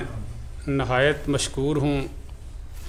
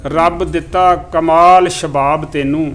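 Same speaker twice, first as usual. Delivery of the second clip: measured, even recital of verse; poem recitation; 4 dB louder than usual. Acted. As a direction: recite each line like a mushaira couplet. Recites Punjabi poem to audience.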